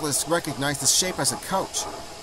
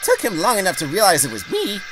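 high, indignant character voice; huffy Daisy; 6 semitones higher, 3 dB louder than usual. A narrator's reading. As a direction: complain in a huff, pitched up. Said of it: "flounced Daisy".

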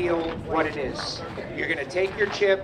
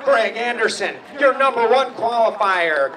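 announcer introduction; 4 semitones higher, 8 dB louder than usual. Real, not acted. Introducing top qualifier to the crowd.

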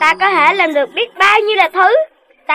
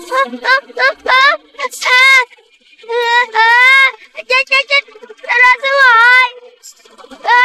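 higher panicked wail while falling; high panicked cries; 6 semitones higher than usual. Acted. Kid falling through the air, high panicky wail. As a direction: cry out in fright as you drop.

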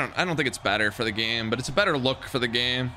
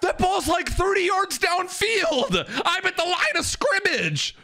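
high, slow mock whine, shouted; mock whiny complaint; slower than usual. Streamer mocking a player's excuse, in a high drawn-out whine. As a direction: pitch it way up and drag out the complaint.

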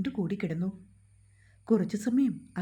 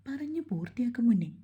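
ordinary delivery, nothing marked

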